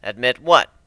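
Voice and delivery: ordinary delivery, nothing marked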